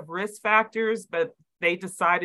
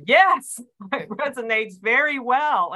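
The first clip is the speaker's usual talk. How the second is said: excited yes; 6 semitones higher, 4 dB louder than usual. Retold or imitated. Retold eager reply to Chris.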